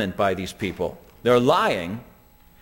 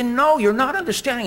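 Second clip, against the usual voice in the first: getting mad; 8 semitones higher, faster than usual. Retold or imitated. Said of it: "And she was getting mad".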